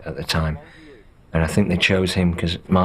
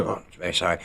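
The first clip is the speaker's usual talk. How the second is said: meek apology; 2 semitones lower, 6 dB quieter, much faster than usual. Retold or imitated. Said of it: "And Viggo go".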